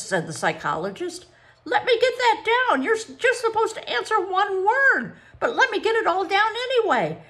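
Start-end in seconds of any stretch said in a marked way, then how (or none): 1.6-7.2 high, loud, excited gush; barely contain your excitement; breathless excitement. A narrator's reading. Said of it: "She panted in excitement".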